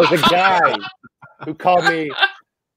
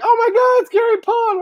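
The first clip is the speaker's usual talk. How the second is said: high squealing fangirl gasp, much higher; starstruck fan girl; 3 dB louder than usual. Retold or imitated. Fans at Frightmare spotting the artist, high squeal. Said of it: "girls are like".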